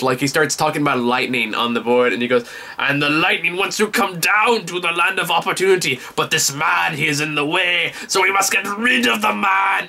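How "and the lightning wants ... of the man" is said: higher, impassioned Russian teacher impression; hammed-up Russian accent, building to the end; thick Russian accent. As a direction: rant like a Russian immigrant lecturer. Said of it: "he talks with a thick Russian accent".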